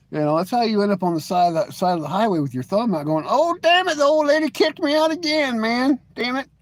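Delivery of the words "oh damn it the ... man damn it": mock stranded trucker. Hitchhiker kicked out, complaining.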